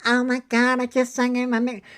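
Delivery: squeaky high mocking cartoon voice; Mickey Mouse on helium. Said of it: "Mickey Mouse on Helium".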